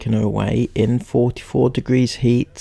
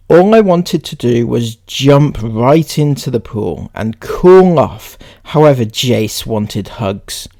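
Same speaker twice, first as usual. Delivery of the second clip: louder, projected reading voice; read-aloud; 6 dB louder than usual. Acted. Reading someone's post to viewers, emphatic read-aloud.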